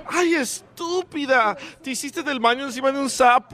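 campy guy. Acted. Roommate scolds the other.

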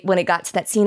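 ordinary talk

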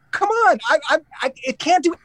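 high-pitched, stammering retold protest; exasperated pleading. Golfer arguing with the caddy over a putt, exasperated pleading. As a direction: plead in disbelief, voice pushed up high.

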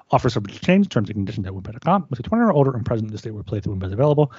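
rushed fine print; fast legal disclaimer. Acted. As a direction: rattle off the terms quickly.

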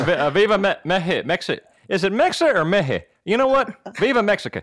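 put-on Southern accent. Panelist fumbling a patriotic cheer, Southern accent. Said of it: "in your Southern accent".

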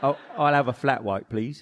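put-on Australian that lands near London; botched Australian accent, ordering coffee; bad Australian accent. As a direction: have a go at Australian, slipping into Cockney. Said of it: "just straight up Nigel Tufnell from Spinal Tap".

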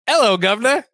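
mock British greeting, lifted up high; a quick stab at a Brit; hammed-up British hello; British accent. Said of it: "like a, like a Brit".

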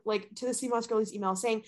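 ordinary talk

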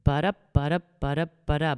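ba-da ba-da with the beat on each second syllable; rhythmic da-DUM chant; iambic rhythm. Shown as a demonstration. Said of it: "iambic pentameter is".

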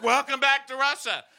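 high, hammed-up cheer; mock rousing welcome. Viewers rooting for spies going home, hammed-up cheer.

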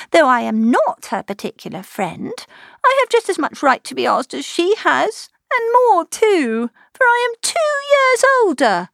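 put-on jealous sister insisting she is older; peevish. A narrator's reading. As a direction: build to a high sulky whine. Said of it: "her accent was peevish".

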